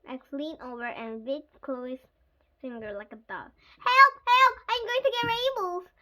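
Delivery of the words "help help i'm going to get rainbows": high, loud cry for help; screaming. A narrator's reading. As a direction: scream in alarm like a bitten child. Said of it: "Chloe screamed".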